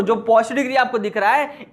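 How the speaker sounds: ordinary talk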